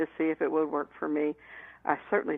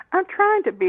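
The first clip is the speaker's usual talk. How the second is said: flustered; 13 semitones higher, 7 dB louder than usual. Retold or imitated. Flustered reply to mother.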